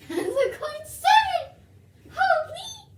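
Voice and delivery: high, loud laughing squeal; laughing excitedly. Real, not acted. Laughing and excited over the cat.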